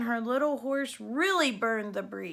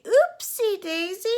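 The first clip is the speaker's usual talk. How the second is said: stepsister; 8 semitones higher, 7 dB louder than usual. A narrator's reading. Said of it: "before she could say".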